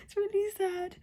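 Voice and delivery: tearful voice climbing high, half laughing. Real, not acted. Reader upset over rescue dog's death, tearful and rising. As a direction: laugh at yourself through real tears. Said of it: "why am I crying".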